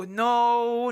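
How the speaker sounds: normal talking voice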